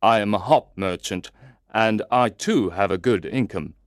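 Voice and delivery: usual speaking voice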